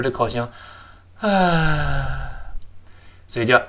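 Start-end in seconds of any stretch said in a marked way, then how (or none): 1.2-2.5 long falling open sigh "ah"; demonstrated sigh. Shown as a demonstration. Teacher models the mouth shape of sighing, long falling sigh. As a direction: let out a long, exaggerated sigh. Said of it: "就变成我们在叹气的时候".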